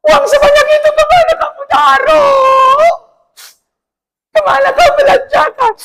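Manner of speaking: very high, loud shouted scolding, half laughing; angry husband shout. Acted. Husband scolding wife over lost money, high shouted fury. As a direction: blast the money question like a furious husband.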